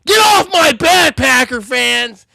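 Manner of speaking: loud, slow shout at the fans. Real, not acted. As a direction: yell it at the Packer fans slowly.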